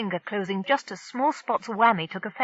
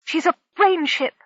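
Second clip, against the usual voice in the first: shocked; 10 semitones higher, 4 dB louder than usual. Acted. Shocked discovery of the ship.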